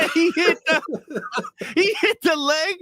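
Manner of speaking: high, squeaky talk through laughter; helpless real laughter breaking up the words; cracking up. Real, not acted. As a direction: barely get the words out for laughing. Cracking up while retelling the gag.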